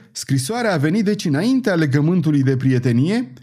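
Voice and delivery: high, upset outcry; king shouting. A narrator's reading. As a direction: shout the question in alarm. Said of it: "regele strigă".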